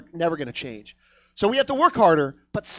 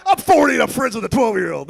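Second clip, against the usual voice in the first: loud, higher goofy declaration; mock creepy boast; 4 semitones higher, 8 dB louder than usual. Comic mocking a misread question, loud silly boast. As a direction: announce it far too proudly.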